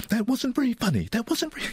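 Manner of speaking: high-pitched mimicry of a disapproving lady; imitated complaint in a high voice; unamused lady passenger. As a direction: put on a high, put-out voice.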